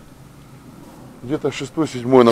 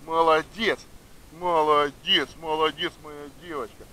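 high praise fading to soft; talking to a dog; 5 semitones higher, 5 dB quieter than usual. Real, not acted. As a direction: praise the dog, trailing off tenderly.

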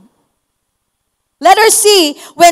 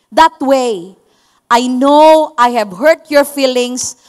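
sincere apology; 6 semitones lower than usual. Shown as a demonstration. Preacher modelling an apology without excuses, sincere. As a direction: admit fault gently and humbly. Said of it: "It's something like this".

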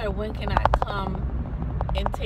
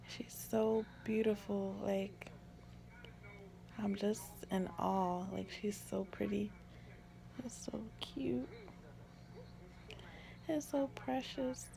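tender awe; 12 dB quieter than usual. Real, not acted. New mother in awe of her baby, hushed and tender. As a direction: softly marvel over your newborn.

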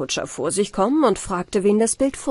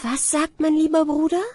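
put-on higher, innocent girlish question; young bride asking; 8 semitones higher than usual. A narrator's reading. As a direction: ask sweetly like a trusting young bride. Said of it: "Die Braut fragte".

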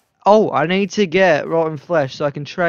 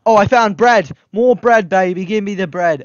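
loud, high excited yelp; thrilled about the bread; louder, higher delight; excited find; 5 semitones higher, 8 dB louder than usual. Real, not acted. Finding bread in chest.

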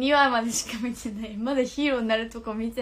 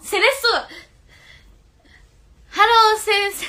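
excited; 8 semitones higher, 10 dB louder than usual. Real, not acted. Delighted at a new nickname.

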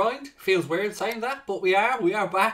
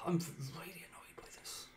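defeated breathy whisper; 17 dB quieter than usual. Real, not acted. Gamer sulking after a late defeat, whispering. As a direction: hush it, deflated.

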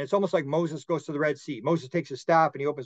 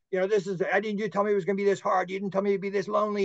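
higher-pitched, quick protest, not really shouted; retold yelling; 5 semitones higher, faster than usual. Man protesting to the beings, mock yelling without the volume. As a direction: imitate an upset, hurried complaint. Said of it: "he's yelling and screaming at these beings".

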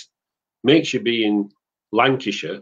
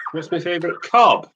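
ordinary talk, nothing marked

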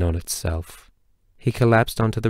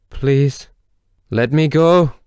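high, loud, desperate plea; desperate pleading; 6 semitones higher, 6 dB louder, slower than usual. Acted. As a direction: beg for your life.

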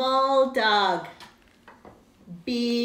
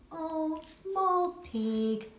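slow, small voice; 5 semitones higher, 4 dB quieter, slower than usual. Acted. Contrasting big and small.

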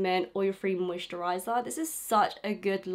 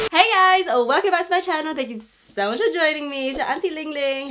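vlog intro; 7 semitones higher, 7 dB louder than usual. Real, not acted. Cheery YouTube intro greeting.

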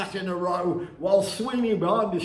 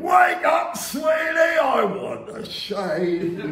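loud, high call to wake him; devil calling; 7 semitones higher, 5 dB louder, slower than usual. Acted. Old Nick calling Sweeney from the grave, loud. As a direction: bellow him awake.

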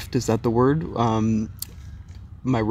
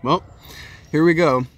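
excited; 6 semitones higher, 5 dB louder than usual. Real, not acted. About to take the LSD.

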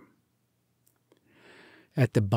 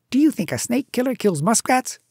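high, scornful challenge; 11 semitones higher, 4 dB louder than usual. A narrator's reading. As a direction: toss it back with disdain. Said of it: "said Rikki Tikki scornfully".

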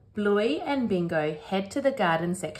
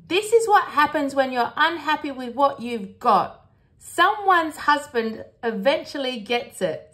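high, louder lecturing mother; scolding Mum; 7 semitones higher, 5 dB louder than usual. Acted. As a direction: tell the kids off as their mum. Voices Mum telling the children off.

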